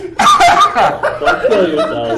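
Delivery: breathless high laughter; laughing too hard to speak. Real, not acted. Laughing at choking player.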